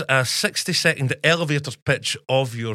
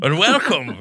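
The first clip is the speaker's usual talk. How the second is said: higher, louder hammed-up welcome; announcer echo; 5 semitones higher, 5 dB louder, slower than usual. Host echoing the guest's mock opening, playful announcer. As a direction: echo the host like a radio jingle.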